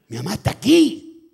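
high, loud excited yell; excited insistence. Retold or imitated. Boy telling playmates his mother has come, shouting. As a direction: shout it, rising on the last word.

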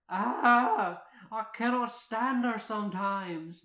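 loud, high exasperated outburst; Merida. A narrator's reading. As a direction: groan out loudly in exasperation. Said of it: "Merida says".

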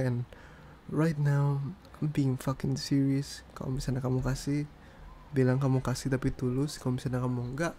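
ordinary talk, nothing marked

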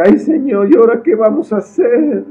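panicked outcry. Acted. Servant surrounded by the army, crying to the prophet in panic. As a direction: shout in terror for help. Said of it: "Y entonces dice".

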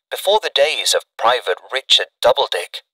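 ordinary delivery

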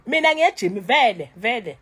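loud defiant retort. Real, not acted. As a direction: throw it back at them.

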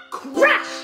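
high squealing laugh on crash; squealed crash. Acted. Sound effect in song.

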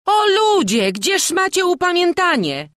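very high, loud exclamation; woman's cry. A narrator's reading. A townswoman scolds the crowd, crying out. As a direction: cry out in protest at high pitch. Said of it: "krzyknęła ta sama kobieta".

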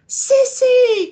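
very high, loud relieved shout; overjoyed call of found sister; joyful cry. Acted. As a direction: shout her name with relief. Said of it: "cried Horace".

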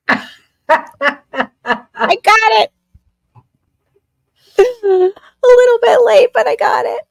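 high, laughing through the words. Real, not acted. Laughing as she finally gets the liver joke. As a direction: giggle it out, finally getting the joke.

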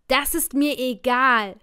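loud, slow call over the crowd; shouting. Acted. Narrator calls to the security man over the crowd, loud and slow. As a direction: yell it back over the noise. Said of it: "schrie ich ihm entgegen".

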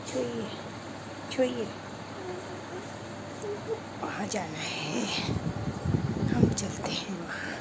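slow hushed whisper so the sister sleeps on. Real, not acted. Mother whispering to her son beside his sleeping sister, slow hushed whisper. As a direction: breathe the words without voice, slowly.

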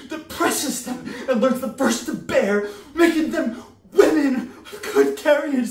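high, shouted, escalating tirade; frenzied rant. Acted. Mercutio ranting about the hag Mab, frenzied shouting. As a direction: build to a wild high-pitched shout.

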